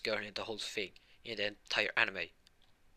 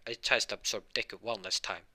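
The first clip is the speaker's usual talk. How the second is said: ordinary delivery, nothing marked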